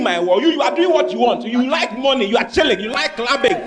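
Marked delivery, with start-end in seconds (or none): none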